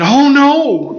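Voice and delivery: imitated gasp of worry; Miriam's panic. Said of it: "I can just see her thinking".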